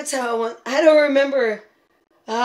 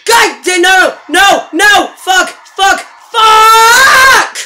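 loud, furious swearing at the game; furious shouting; 8 semitones higher, 8 dB louder, much slower than usual. Real, not acted. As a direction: yell every curse in real rage. Raging at the race game.

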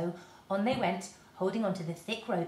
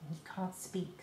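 ordinary delivery, nothing marked

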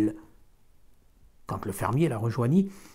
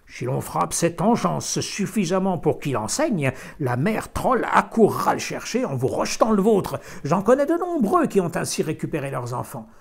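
put-on stern old woman; 4 semitones higher, 6 dB louder than usual. A narrator's reading. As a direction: lecture the mother sternly. Old woman giving advice.

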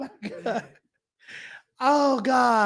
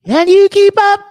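loud shout; 11 semitones higher, 13 dB louder than usual. Pretend. Loud mock challenge.

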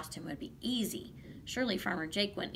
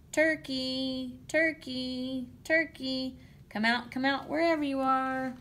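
higher singsong storybook call; 5 semitones higher, 4 dB louder, slower than usual. A narrator's reading. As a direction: call out to coax someone from hiding. Farmer calls out for Turkey.

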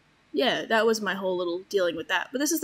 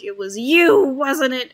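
a louder mock accusation; accusing chicken man; 4 semitones higher, 9 dB louder, slower than usual. Chicken man accusing the squid at the feast, mock angry. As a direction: slam it down as an accusation. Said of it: "been like".

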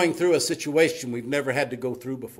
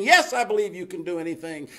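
lightly voiced higher, insistent yes; mildly mock the man's urgent agreement; desperate cliff man; 7 semitones higher, 5 dB louder than usual. Cliff man assuring God he can do anything, mildly desperate.

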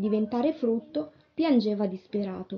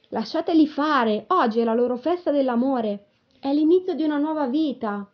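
raised, emphatic reassurance; lightly voiced old tree; 5 semitones higher, 5 dB louder than usual. A narrator's reading. Cherry tree defends the flowers' love feast, indulgent. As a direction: proclaim it as an understanding elder.

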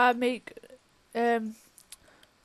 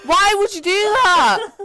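higher, louder despairing cries; distraught yelling; 6 semitones higher, 3 dB louder, slower than usual. Real, not acted. Gamer yelling at partner that everything is gone, high and loud. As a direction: shout in disbelief.

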